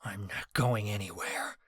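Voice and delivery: soft, low growl. A narrator's reading. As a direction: growl it with quiet defiance. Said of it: "I growled".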